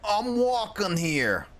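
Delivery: high, slow, stretched mock East Coast accent. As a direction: draw it out as an East Coast pedestrian. Playful East Coast accent impression.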